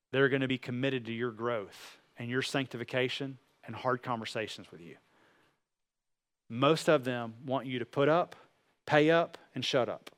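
normal talking voice

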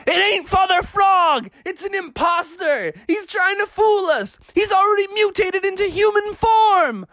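put-on high, shouted kid alarm; frantic accusation. Family refuses to believe it's dad, frantic. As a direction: yell it high like panicked ghost hunters. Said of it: "And they're like".

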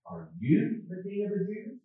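soft question jumping high on YOU; stress on YOU. Shown as a demonstration. Preacher re-reading Pilate's question to Jesus, stress on YOU. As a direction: swing up sharply on YOU and stay high. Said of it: "with the emphasis on the word "you".

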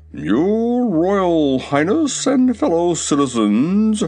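slow, grand and self-important; pompous. A narrator's reading. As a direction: strut through it pompously. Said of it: "His tone was pompous".

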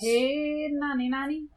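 high teasing sing-song; playful sing-song call. Pretend. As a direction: call her name like a tease.

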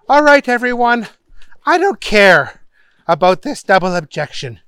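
judge's mildly fed-up announcement; weary judge. A narrator's reading. Tired judge dismissing court for lunch, mildly exasperated. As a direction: call the recess with worn patience. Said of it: "The judge was getting very, very tired".

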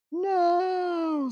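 a sharp high shouted "No"; sudden shout. Acted. Reading Ace's chat reply as a sudden shout. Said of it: "says, uh, Ace".

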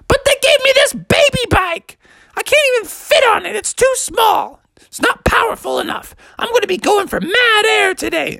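put-on very high squeaky little-kid complaint; whining Junior. Junior complaining to Father about the baby bike, squeaky whine. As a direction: protest in a squealing tantrum voice. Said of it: "Junior's like".

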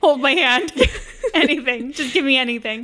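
laughing mock begging; laughing plea. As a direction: beg through laughter. Mocking affection starved plea.

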